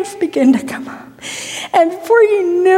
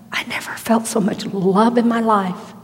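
hushed whisper; 5 semitones lower, 5 dB quieter than usual. Real, not acted. Hushed retelling of feeling love.

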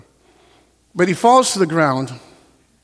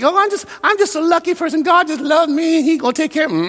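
imitating smug naivety, pitched up; mock complacency; 10 semitones higher than usual. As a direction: mimic someone blithely sure they are favored. Mocks a complacent believer's thinking.

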